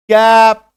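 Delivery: कैप said loud with a long, pushed ae vowel; forceful cap. Shown as a demonstration. Teacher demonstrating word sounds, forceful कैप. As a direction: hit कैप hard on its vowel. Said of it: "थोड़ा जोर लगा के करेंगे".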